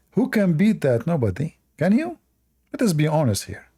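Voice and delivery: ordinary delivery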